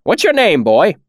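put-on high, loud gruff old man; old villager voice. A narrator's reading. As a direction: demand it bluntly. Cobb questioning.

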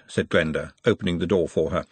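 ordinary delivery, nothing marked

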